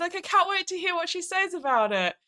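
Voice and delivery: high gushing praise. Retold or imitated. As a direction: gush with wide-eyed wonder. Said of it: "I'm like".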